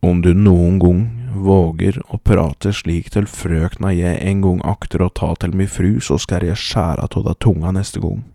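loud, slow, forceful warning; loud threat. Acted. Hunter warns the man who insulted his sweetheart, loud threat. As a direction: threaten him with full weight on each word.

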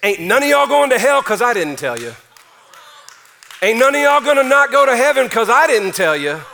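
Paul declares it to the church, loud; bold Paul. Retold or imitated. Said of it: "here's what he's saying".

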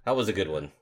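ordinary talk, nothing marked

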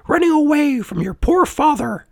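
very high, tiny insect voice; squeaky reproach; scolding cricket. A narrator's reading. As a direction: tell them off as a tiny cricket. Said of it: "the cricket said".

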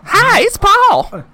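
high, slow goofy greeting; silly voice. Pretend. Friend mocking an intro, high goofy voice.

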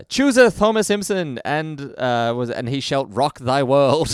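loud, high, slow olde-English proclamation; town crier. Retold or imitated. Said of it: "instantly picks up ye old talk".

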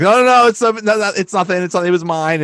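high, loud flustered denial; mock panic. Fan acts boss confessing as cops come, flustered. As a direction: blurt out frantic protests, then ease off.

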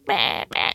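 low droning meh, meh; imitated MRI noise. To listeners, copying the MRI machine's sound, low mock drone. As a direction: mimic the machine's low buzzing. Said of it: "sort of like".

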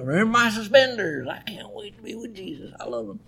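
high, fading old-man imitation; old professor. Old professor reminding his class, high voice fading away. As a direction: mimic the old professor, trailing away very softly. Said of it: "he'd say the same thing".

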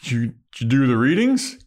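tentative, halting opener; awkward. Retold or imitated. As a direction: stumble into small talk, awkwardly. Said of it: "he's all awkward".

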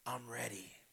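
whispered, slow yielding; low, hushed surrender. A narrator's reading. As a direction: breathe the words out in devotion. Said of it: "His voice came low".

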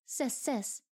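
stuttering on the first sound. Acted. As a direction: catch on the s and restart. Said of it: "the elf stuttered".

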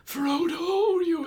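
high, loud mock-crazed cry; hammed-up unhinged elf queen. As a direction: call out high and wild, in parody. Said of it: "goes crazy and she's like".

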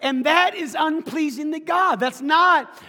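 high, loud sermon delivery, building; emphatic preacher driving the point home; impassioned preaching. Real, not acted. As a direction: preach it with rising force. Preaches to congregation, building in force.

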